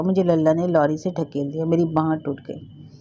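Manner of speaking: usual speaking voice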